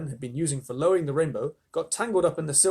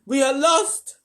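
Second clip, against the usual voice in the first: crying out; 11 semitones higher, 8 dB louder, slower than usual. A narrator's reading. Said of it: "Miss Spider cried".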